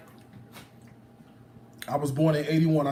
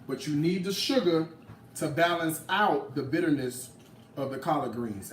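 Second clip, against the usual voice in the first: ordinary delivery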